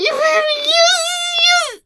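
very high, wailing cries; climactic moaning. Acted. Wife carried away on the phone, wailing.

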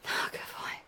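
breathless whispered praise. Acted. Praises her partner after climax, whispered. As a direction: drop to a bare whisper.

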